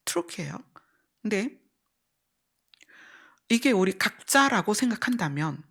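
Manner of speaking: ordinary delivery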